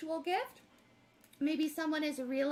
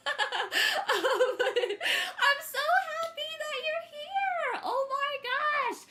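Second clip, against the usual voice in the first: high, louder laughing gush, slow and teary; 10 semitones higher, 6 dB louder, much slower than usual. Real, not acted. Reader welcoming Ong back, laughing with joy. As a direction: laugh and nearly cry with joy.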